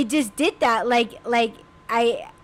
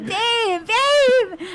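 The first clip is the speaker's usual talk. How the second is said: high, rising calls of "babe"; retold screaming; 9 semitones higher than usual. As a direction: call out to him, half panicked. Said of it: "I was screaming".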